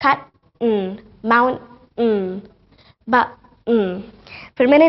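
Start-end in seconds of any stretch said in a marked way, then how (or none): 0.1-3.3 खट, माउंट, बा each stopped short, then a hummed N; vowel dropped before N. Shown as a demonstration. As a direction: break each word: stop, then "n". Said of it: "वो तभी एन को pronounce कर देते हैं".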